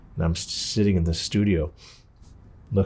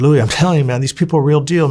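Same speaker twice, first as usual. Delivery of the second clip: higher and louder, pressing the point hard; insistent urging; 7 semitones higher, 6 dB louder than usual. Retold or imitated. Nephew pressing his doubtful uncle, insistent urging. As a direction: convince him these people are real.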